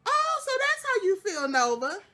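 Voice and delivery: high, indignant surprise; calling out Nova, high and offended. Real, not acted. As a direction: act hurt and taken aback. Mock-offended reaction to Nova.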